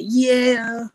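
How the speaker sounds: loud, high raspy "Yeah" shouted like on a record; ad-lib yeah demo. Shown as a demonstration. Rapper shows the interviewer how his ad-lib sounds. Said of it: "Once you hear that".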